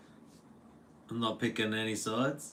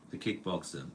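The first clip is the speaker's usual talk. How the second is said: ordinary delivery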